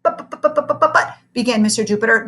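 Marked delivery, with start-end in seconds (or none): 0.0-1.2 1 panicked sputter. A narrator's reading. Panicked stammering protest.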